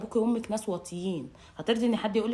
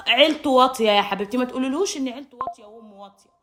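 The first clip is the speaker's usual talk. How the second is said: normal talking voice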